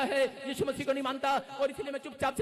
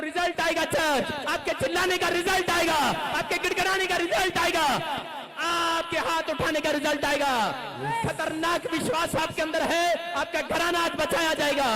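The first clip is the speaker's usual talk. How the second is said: loud, higher preacher's shout; preaching; 5 semitones higher, 6 dB louder than usual. Acted. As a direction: proclaim the result to the church. Preaching at full force. (ज़ोरदार प्रचार)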